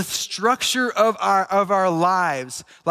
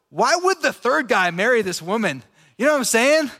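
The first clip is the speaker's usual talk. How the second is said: ordinary talk, nothing marked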